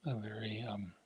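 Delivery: drowsy, half-asleep murmur; drifting off to sleep mid-sentence; nodding off. Real, not acted. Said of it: "as you can tell, I'm falling asleep".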